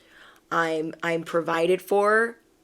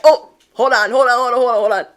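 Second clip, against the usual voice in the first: higher, louder frantic stalling voice; a voice scrambling to stop you, high and loud; higher, louder panicked "hold on"; subconscious voice; 6 semitones higher, 8 dB louder, faster than usual. Pretend. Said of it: "your subconscious is gonna be like".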